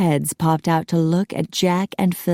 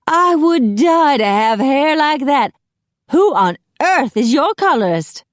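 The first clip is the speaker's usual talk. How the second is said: high, loud melodramatic admiration; swooning envy; 9 semitones higher, 5 dB louder than usual. A narrator's reading. Macy covets Phil's hair, high theatrical swoon. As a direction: swoon over it with breathtaken envy. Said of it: "pressed a hand against his flat bosom".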